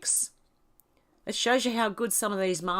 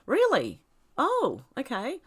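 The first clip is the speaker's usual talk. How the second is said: surprised; 6 semitones higher, 3 dB louder than usual. Real, not acted. Reacting to surprising comment.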